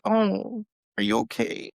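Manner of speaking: teasing fake worry from the little daughter; mock sympathy. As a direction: imitate a child's sly concern. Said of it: "she's like".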